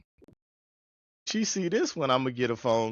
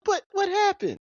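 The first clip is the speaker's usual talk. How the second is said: high mock protest; 13 semitones higher than usual. Son to his stubborn mama, high indignant protest. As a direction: squeak out a wronged protest.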